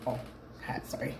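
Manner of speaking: ordinary delivery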